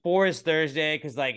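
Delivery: ordinary delivery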